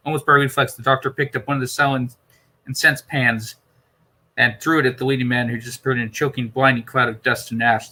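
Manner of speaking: ordinary delivery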